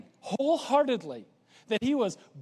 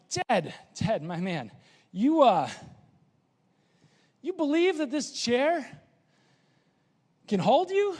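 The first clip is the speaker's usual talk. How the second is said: rising, higher challenge; James testing Ted; 3 semitones higher than usual. Retold or imitated. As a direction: lean in and test him. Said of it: "what he's trying to teach us is going".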